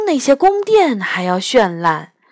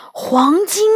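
breathless stunned question; shocked; 8 semitones higher than usual. Acted. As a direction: gasp out the word in shock. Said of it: "我大吃一惊". (大吃一惊地问)

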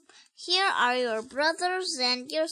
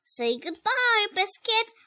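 plain reading voice

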